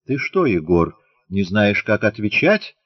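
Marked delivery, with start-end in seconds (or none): none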